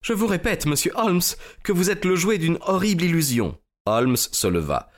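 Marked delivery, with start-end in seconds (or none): 0.0-3.6 high-pitched lady's voice, protesting; proud lady holding her line; insistent lady. Acted. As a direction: insist in a raised, higher pitch. Lady Hilda denies to Holmes.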